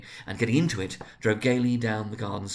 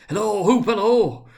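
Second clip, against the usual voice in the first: loud, much higher hail; 1 calling out; 11 semitones higher, 10 dB louder, slower than usual. A narrator's reading. Scrooge hails the storybook figure, calling out loudly. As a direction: call across to him joyfully.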